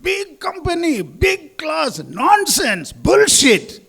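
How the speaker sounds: imitated furious customer's last yell; parting shout. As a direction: storm out shouting abuse. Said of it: "He gave one more shout".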